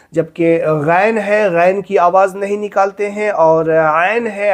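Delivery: ordinary talk, nothing marked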